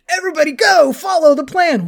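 high-pitched mock hero's call to charge; rallying battle cry. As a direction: call the troops forward, mimicking a leader. Acting Captain America leading the charge.

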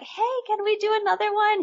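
put-on high, eager little boy voice; kid voice. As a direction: pitch way up like an excited kid. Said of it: "he's like".

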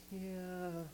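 high, soft, drawn-out yeah; sheepish hedging. Retold or imitated. Gideon reluctant after the angel shows proof, sheepish high hedge. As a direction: stall on a high, held note before asking a favor.

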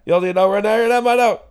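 imitating a frantic woman asking about her dog; high-strung neighbour. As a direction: rattle it off like a wired stranger. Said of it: "quoting the woman again".

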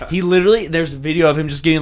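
normal talking voice

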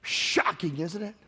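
quiet aside. Real, not acted.

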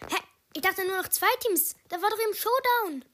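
loud, high baffled outburst; high, loud "huh?" energy; confused shout. Real, not acted.

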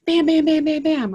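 loud, high pounding bam bam; mimicking door pounding; loud knocking. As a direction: bang out each bam like fists on a door.

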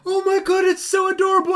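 high, loud coo of adoration; gushing mock delight. Imitating a viewer lured in by a cute video, gushing. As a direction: gush in a high, hammed-up squeal.

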